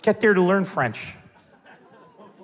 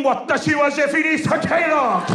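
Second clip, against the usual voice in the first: high, mock Japanese anime announcement; Japanese cartoon voice; 7 semitones higher than usual. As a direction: declare it like a Japanese cartoon fighter. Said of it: "if this was a Japanese cartoon".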